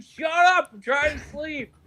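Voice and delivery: high shouted complaint from a woken neighbour; hammed-up sleepy neighbour yelling; mock angry shout. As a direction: yell it like you were just woken up. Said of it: "He's like".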